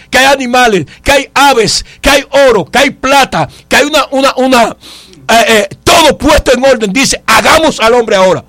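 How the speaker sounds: forceful preacher building through a list; preaching. Acted. Preacher challenging a brother's doubt about God's power, forceful preaching. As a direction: drive each phrase home to the congregation.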